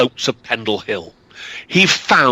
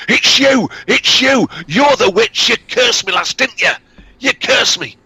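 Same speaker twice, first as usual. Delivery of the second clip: accusing shout; 11 semitones higher, 4 dB louder than usual. Acted. Peddler accuses from sickbed.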